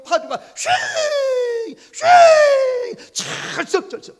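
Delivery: very high, loud wind howl then breathy splashes; howling storm. Acted. Storyteller acts out the storm for children, howling wind. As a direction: whoosh like a roaring storm wind.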